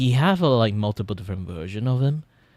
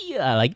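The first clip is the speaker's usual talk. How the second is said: excited squeal; 5 dB louder than usual. Shown as a demonstration. Streamer showing viewers the excited voice, high squeal. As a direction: squeal with over-the-top excitement. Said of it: "when I'm kinda, like, excited".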